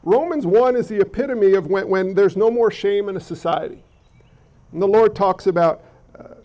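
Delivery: ordinary talk, nothing marked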